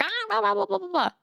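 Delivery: mocking chatter. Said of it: "everybody's like".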